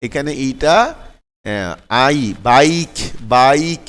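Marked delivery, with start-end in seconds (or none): 2.5-3.8 very slow বাইক, stretching the "ai"; I sounded "ai". Shown as a demonstration. Teacher shows learners the middle sound of bike, slow drawn-out demo. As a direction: draw out the middle of বাইক each time. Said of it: "একটা আইয়ের মতো উচ্চারণ এখানে".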